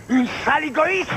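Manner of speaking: furious, contemptuous shout; angry outburst. Acted. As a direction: spit the insult out, loud and high. Cult leader scolding a mother.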